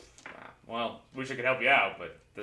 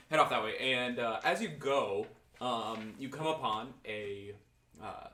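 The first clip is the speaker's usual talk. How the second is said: normal talking voice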